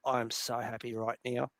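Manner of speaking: soft, relieved murmur; a quiet, blissful sigh of relief; contented sigh. Retold or imitated. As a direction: sink back satisfied, softly. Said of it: "I sat down and I was like".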